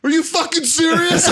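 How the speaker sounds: very high mock-hysterical cry; high-pitched parody of a distraught prank victim; imitated incredulous outburst. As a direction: squeak in stunned disbelief. Retold victim's reaction to prank.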